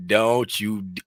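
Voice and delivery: ordinary talk, nothing marked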